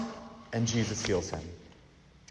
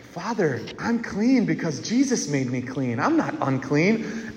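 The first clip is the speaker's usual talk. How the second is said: embarrassed mumble; 6 dB quieter than usual. Retold or imitated. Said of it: "you're so embarrassed that you don't even want to say it".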